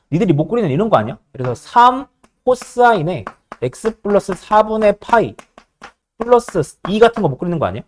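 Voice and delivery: ordinary talk, nothing marked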